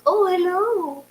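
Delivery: put-on high, soft, slow older Eastern European neighbor; soft, high, drawn-out accented voice; [name]. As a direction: imitate a hesitant Eastern European lady, high and quiet. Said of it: "She talks like that".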